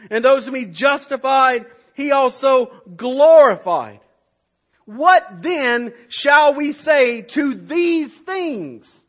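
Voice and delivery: loud, ringing pulpit delivery, each phrase hammered; preaching at full force. Acted. Pastor proclaims the Romans 8 chain to the church, full-force preaching. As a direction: preach it to the back row.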